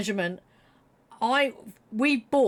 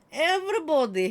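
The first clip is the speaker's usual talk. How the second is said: usual speaking voice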